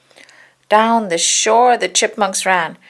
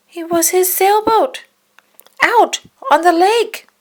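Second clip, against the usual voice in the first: high, louder shocked discovery; surprised; 13 semitones higher, 5 dB louder than usual. A narrator's reading. Narrating Donald seeing his boat on the lake, high and surprised. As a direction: gasp at the stolen boat.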